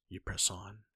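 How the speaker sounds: plain reading voice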